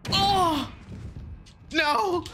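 high-pitched cries of panic; real gamer panic, laugh breaking in; frantic yelling. Real, not acted. Frantic struggle in the match.

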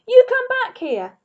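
very high, loud yell; angry shout. Acted. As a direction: holler after the runaway dog. Ernest chasing the dog.

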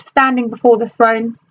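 ordinary delivery

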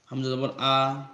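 ordinary delivery

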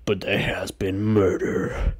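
slow, dark announcer voice; dramatic intro. Acted. Host opening his murder show, slow spooky announcer. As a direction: draw out the welcome like a horror host.

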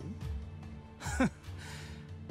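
short airy scornful laugh; scoffing laugh. Acted. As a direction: scoff under the breath. Scoffs at Hakua building people.

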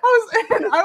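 can barely speak for laughing; wheezing, high laughing disbelief; helpless laughter. Real, not acted. Still laughing.

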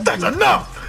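high, loud shout. Real, not acted. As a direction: cut them off, yelling. Stops friend's ad bit.

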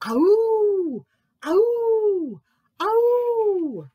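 high, loud drawn-out howl; loud wolf-cub howl sliding down at the end; awful howl. A narrator's reading. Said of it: "he heard an awful howl".

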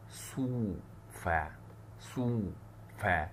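sofa drawn out slowly, syllable by syllable. Shown as a demonstration. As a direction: stretch سوفا long and slow. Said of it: "هقول سو".

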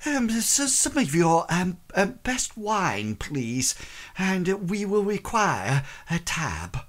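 high, trembling voice covering nerves; shaky voice. Acted. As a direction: speak high and shakily, forcing calm. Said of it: "in an almost, like, shaky voice".

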